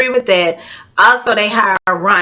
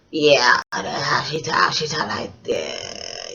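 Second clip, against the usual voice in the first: low, slow put-on voice, dropped much lower; imitating Big Ange; 8 semitones lower, 7 dB quieter, slower than usual. Vlogger doing Big Ange's voice for her viewers, low and slow. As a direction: sink into a low, dragging imitation of her speech. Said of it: "She talks like this".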